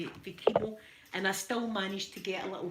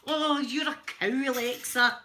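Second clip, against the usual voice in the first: higher, louder hammed-up insult; comic outburst of exasperation; mock-angry scolding; 4 semitones higher, 6 dB louder than usual. Woman scolds Alexa for not helping, mock-angry. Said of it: "I end up going like".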